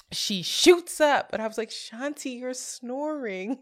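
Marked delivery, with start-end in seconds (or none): 1.7-3.6 soft, higher-pitched retold nudge; gently waking someone. Waking a snoring friend in bed, softly. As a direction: tell a sleeper softly, drawing out the last word.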